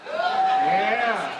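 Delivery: fast rising auction chant; auctioneer. Acted. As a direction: call bids like an auctioneer. Live auction calling.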